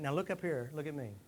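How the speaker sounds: normal talking voice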